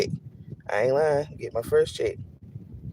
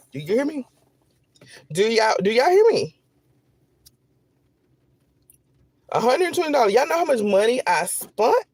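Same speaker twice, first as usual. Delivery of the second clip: high, incredulous cry; 높은 목소리로 믿기지 않는 듯; outraged disbelief; 7 semitones higher than usual. Real, not acted. Outraged at tiny paycheck.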